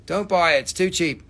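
ordinary talk

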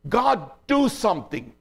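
high, emphatic plea; crying out to God. Retold or imitated. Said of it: "he's crying out to God".

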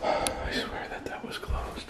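hushed, breathless whisper of fear; scared whisper. Real, not acted. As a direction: whisper it so nothing hears you. Alone, spooked in haunted house.

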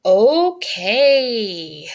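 long drawn-out, loud okay; slow, doubtful. A narrator's reading. Court, still confused by the explanation, answers slowly. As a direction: stretch the word out slowly. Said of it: "said Court slowly".

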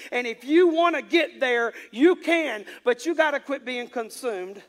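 loud, high-pitched pulpit delivery; full-force preaching. Real, not acted. Preacher urges congregation toward the other side, full-force preaching. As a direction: drive each phrase home to the congregation.

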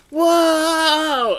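high, loud drawn-out whoa; crowd whoa. Retold or imitated. Audience picking up the photos, loud surprised whoa. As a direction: gasp like you just saw something wild.

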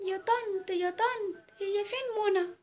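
high kiddie voice calling to auntie; small children. Acted. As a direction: pipe up like little kids asking a grown-up. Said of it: "العيال أصحابها الصغننين". (صوت عيال صغيرين بينادوا طنط)

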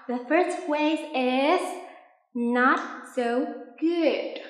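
slow, clear model phrase for repeating; teacher's model phrase. Shown as a demonstration. Teacher modelling a phrase for students, slow and clear. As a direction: spell it out slowly for the class. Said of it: "các bạn lặp lại giúp mình nhé".

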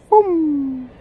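high, punchy boom like hair springing up; sound effect boom. Acted. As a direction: pop the word like a little explosion. Hair standing on end.

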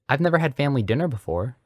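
high, quick, eager rush; excited. A narrator's reading. Boy invited to his first family dinner, excited. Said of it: "Harry gasped excitedly".

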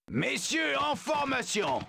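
high shouted order to the troops; shouted command. Acted. Zoo guard calling his men to line up, shouted command. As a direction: bark it like a sergeant.

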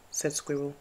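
ordinary delivery, nothing marked

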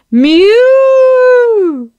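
high, loud hooting whistle cry; ship whistle. A narrator's reading. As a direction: imitate a steamer whistle blaring. Ship whistle sounds from shed.

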